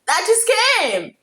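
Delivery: excited high voice reading emails; high, eager retelling of reading the email. Retold or imitated. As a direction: act out opening the news, eager. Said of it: "I was like".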